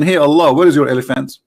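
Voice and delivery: normal talking voice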